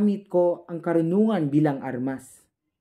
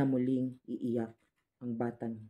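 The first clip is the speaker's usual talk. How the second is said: hushed, solemn close; 3 semitones lower, 8 dB quieter than usual. Acted. Closing line of a sorrowful monologue.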